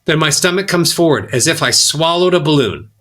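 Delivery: normal talking voice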